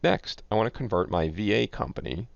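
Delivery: normal talking voice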